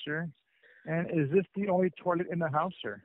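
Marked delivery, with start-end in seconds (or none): none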